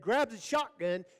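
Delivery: ordinary delivery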